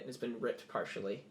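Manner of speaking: normal talking voice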